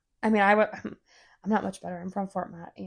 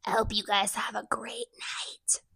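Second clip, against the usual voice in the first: raspy whisper; 6 dB quieter than usual. Pretend. Whispered sign-off in character.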